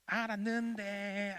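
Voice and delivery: put-on slow, drawn-out childish sing-song reply; cheeky brush-off. Boy answering the scolding adult, cheeky brush-off. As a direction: stretch it out like an unrepentant prankster. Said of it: "아, 이럴까요?".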